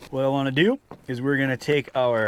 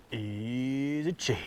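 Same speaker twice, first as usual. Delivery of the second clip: drawn out slow, much softer and hushed; slow soft showcase; 7 dB quieter, much slower than usual. Shown as a demonstration. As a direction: savour it like a fancy product name.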